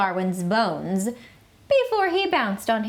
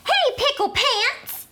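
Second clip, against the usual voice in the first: much higher, laughing holler as Mama; hollering mom. Acted. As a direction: call out to the kid in a high, amused mom voice. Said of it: "hollered Mama".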